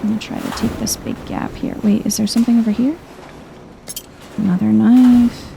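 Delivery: usual speaking voice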